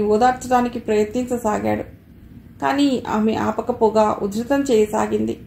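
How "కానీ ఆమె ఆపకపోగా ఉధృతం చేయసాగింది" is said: ordinary delivery, nothing marked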